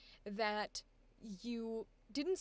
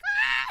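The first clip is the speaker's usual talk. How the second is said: high, loud burst of "Ah!" in awe; overwhelmed cry of delight; excited yell; 13 dB louder than usual. Retold or imitated. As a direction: let out a sudden thrilled shout. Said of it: "I was like".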